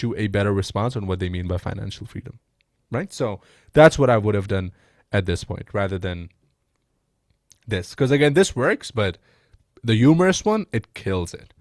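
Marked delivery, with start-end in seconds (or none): none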